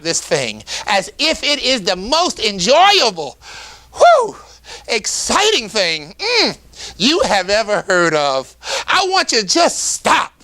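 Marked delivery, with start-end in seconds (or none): 3.9-4.4 high, loud excited whew. Shown as a demonstration. Demo of enthusiastic teaching. 6.2-6.6 delighted hum. Shown as a demonstration. Demo of enthusiastic teaching.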